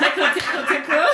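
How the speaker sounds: high, loud playful teasing; playful tickling. Real, not acted. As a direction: tease her while tickling.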